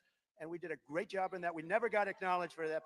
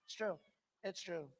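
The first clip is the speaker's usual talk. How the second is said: usual speaking voice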